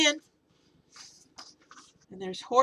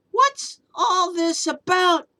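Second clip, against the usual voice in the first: loud, high, slow crowd call; people shouting; 8 semitones higher, 9 dB louder than usual. Acted. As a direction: call out in bewilderment. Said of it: "the people came shouting".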